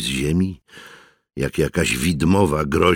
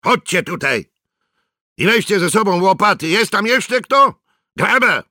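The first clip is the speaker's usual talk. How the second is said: high-pitched, raised voice calling soldiers; rally the men with loud orders; shouting orders; 4 dB louder than usual. A narrator's reading. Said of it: "krzyknął". (wykrzykiwane rozkazy)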